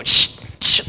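sharp loud shushing to chase a dog. Retold or imitated. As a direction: hiss the dog out of the church. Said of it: "They go".

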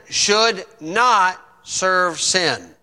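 "should not serve sin" slowed right down with weight on "not"; stressed not. Real, not acted. Preacher to congregation, hammering "not" slowly.

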